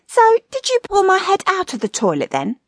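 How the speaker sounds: high comic wife voice; high-pitched, needling question. A narrator's reading. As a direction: ask pointedly in a high wifely voice. Wife questions husband in lounge.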